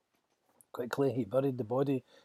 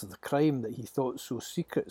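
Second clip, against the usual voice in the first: ordinary delivery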